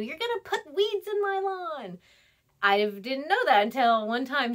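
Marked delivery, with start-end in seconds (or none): 0.0-2.0 high-pitched mock scolding yell; imitated yelling. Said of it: "someone yelled at you".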